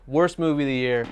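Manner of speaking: normal talking voice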